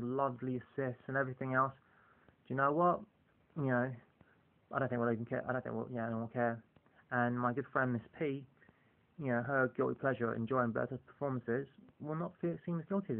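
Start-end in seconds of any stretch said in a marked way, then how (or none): none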